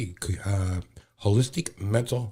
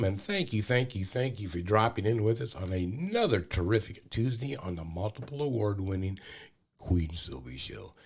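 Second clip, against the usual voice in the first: ordinary talk, nothing marked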